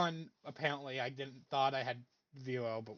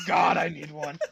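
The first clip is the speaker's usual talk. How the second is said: loud, laughing outburst; laughing shout; 2 semitones lower, 4 dB louder than usual. Real, not acted. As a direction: blurt it out while laughing.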